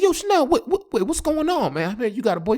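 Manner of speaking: high-pitched, stammered demand for answers; panicked disbelief. Retold or imitated. Man phoning his ex about a new boyfriend, acted panic.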